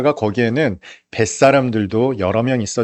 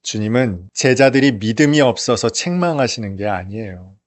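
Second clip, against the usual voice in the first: ordinary talk, nothing marked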